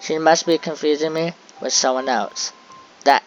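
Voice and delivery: usual speaking voice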